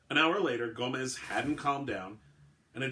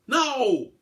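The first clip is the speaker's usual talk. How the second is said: high, loud no; desperate cry; 13 semitones higher, 12 dB louder than usual. A narrator's reading. Billinghurst trying to stop the shooting, loud cry. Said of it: "Billinghurst cried".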